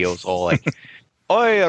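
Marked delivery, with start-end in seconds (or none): 1.3-1.6 high, loud giddy burst of Scottish babble; giddy fast Scot. Retold or imitated. Groom retelling his groomsman's thanks in Scottish, giddy mock babble. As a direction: imitate an excited Scotsman gabbling unintelligibly. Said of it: "he was talking so fast".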